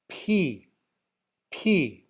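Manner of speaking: "P" said with a puff of air; isolated "P", aspirated, for learners to hear. Shown as a demonstration. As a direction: demonstrate "P" alone, breath released after the consonant. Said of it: "there's aspiration".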